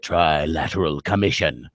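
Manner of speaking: low, slow, menacing read; ominous. Shown as a demonstration. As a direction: make the name sound like a dark secret. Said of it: "when I say it".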